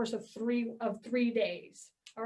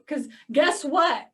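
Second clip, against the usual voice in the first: normal talking voice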